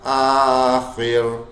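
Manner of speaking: آخر with a thin, light ر, drawn out slowly. Shown as a demonstration. Teacher demonstrating a thin ر in آخر, slowly. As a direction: stretch آخر and keep its ر thin. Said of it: "تو باریک ہوگا".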